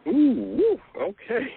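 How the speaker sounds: a playful, drawn-out ooh of surprise; amused surprise. Real, not acted. Host reacts to "painfully true.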